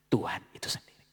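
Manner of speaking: whispered, no voice. Acted. Preacher hushing the congregation, whispered. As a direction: breathe it as a hushed secret.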